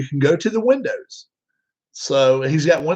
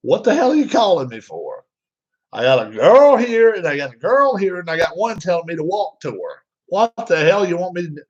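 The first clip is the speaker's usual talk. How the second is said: exasperated rant; 4 semitones higher than usual. Retold or imitated. Dad juggling nurses on the phone, exasperated rant. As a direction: vent like a busy grump.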